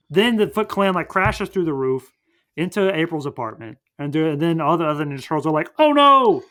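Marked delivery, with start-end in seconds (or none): 5.8-6.4 high, loud cartoon dismay; hammed-up group oh-no; mock alarm. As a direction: cry out in exaggerated panic. Turtles see the attack, retold.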